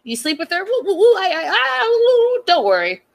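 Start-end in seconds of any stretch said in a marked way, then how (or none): 0.6-2.4 playful warning siren woo. Pretend. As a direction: sound the alarm like a siren. Alarm siren again.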